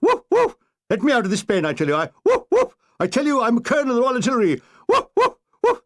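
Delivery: a mad officer half turned dog; barking colonel. Acted. Colonel locked in the dogs' home, barking and protesting in high yelps. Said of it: "barking and foaming at the mouth".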